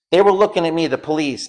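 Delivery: normal talking voice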